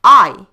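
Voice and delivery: I nearly shouted, a big loud punch; loud teacherly emphasis on I; emphatic gloss. Shown as a demonstration. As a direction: land the meaning I with a burst of volume. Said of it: "ya in Russian means".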